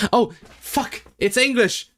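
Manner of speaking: a delighted eureka; surprised squeal of realisation; sudden discovery. Real, not acted. Realising the text is English.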